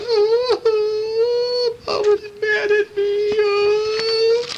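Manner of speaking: very high, crying little wail; whimpering pig. Acted. Truffles walking off, wailing that mom is mad. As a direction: whine it like a sad little piglet. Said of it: "He's going".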